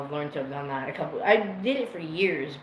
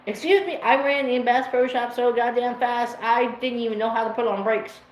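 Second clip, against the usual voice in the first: higher and louder, tumbling with excitement; giddy excitement; 6 semitones higher, 6 dB louder than usual. Retold or imitated. Retelling running into Bass Pro Shop, giddy and loud. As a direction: burst out like a thrilled kid again. Rushing excitedly toward fursuiters.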